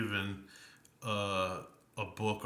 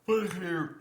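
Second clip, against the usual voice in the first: words stretched by a yawn; 10 semitones higher, 6 dB louder than usual. Real, not acted. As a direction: talk through a real yawn. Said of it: "I'm yawning here".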